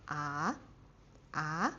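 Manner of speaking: ah sweeping up from low; rising ah. Shown as a demonstration. Teacher shows the second tone, rising. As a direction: glide ah upward. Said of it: "So going up".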